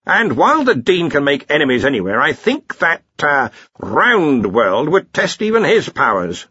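head wizard needling a colleague; Ridcully, dry senior wizard. Acted. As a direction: pronounce loudly from the chair. Ridcully weighs in on Dean.

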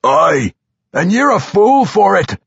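high, loud dwarf retort; fierce high-pitched shout; shouting back. A narrator's reading. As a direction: hurl it back at a foe. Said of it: "the vampire shouted back".

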